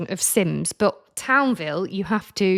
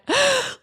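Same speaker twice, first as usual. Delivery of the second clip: excited gasp. Retold or imitated. Showing off game to Kim.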